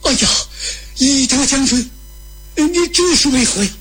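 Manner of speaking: high, alarmed pleading; acted panic, pitched well up; frightened. A narrator's reading. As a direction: plead in fright with an armed attacker. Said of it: "当时把吕布吓坏了".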